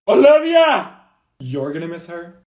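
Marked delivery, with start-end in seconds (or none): 0.1-1.0 high, loud wail of the name; calling out. Acted. Student calling friend on last day, loud wail. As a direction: cry out for your friend.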